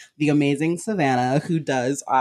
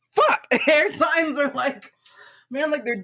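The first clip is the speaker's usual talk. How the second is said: excited outburst; 12 semitones higher than usual. Retold or imitated. Realizing they're an air sign, a high excited shout. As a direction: blurt it in thrilled surprise.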